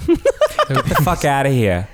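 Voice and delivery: Long Island goodbye. Pretend. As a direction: brush them off like a true Long Islander. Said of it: "as a true Long Islander would say goodbye".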